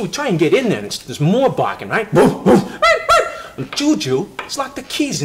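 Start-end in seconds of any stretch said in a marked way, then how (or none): 2.1-2.6 loud booming dog woofs. Retold or imitated. Teller mimicking the big dogs barking, loud woofs. 2.7-3.3 very high, loud little-dog yaps; high yappy barks. Retold or imitated. Teller imitating nervous little Darby, high yapping barks. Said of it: "He's real nervous and high-pitched".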